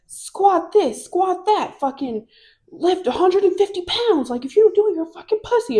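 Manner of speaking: high-pitched imitation of a pushy coach; strength coach. Strength coach drives the class to lift, high and insistent. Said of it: "He's like".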